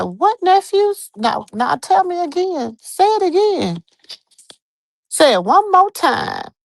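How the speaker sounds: high-pitched, animated demand to hear it repeated; mock-astonished retelling, high and insistent; retold disbelief. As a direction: re-enact your astonished questioning, pitch jumping up. Retelling own startled questioning reaction.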